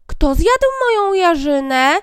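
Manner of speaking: high, loud and slow dwarf. Acted. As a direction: drag it out like a sulky little dwarf. Fourth dwarf asks. (wolny, wysoki głos krasnoludka)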